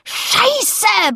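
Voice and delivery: high-pitched cry of dismay; drawn, high swearing outburst; bellowed curse. A narrator's reading. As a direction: yell the curse out. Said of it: "brüllte Anton".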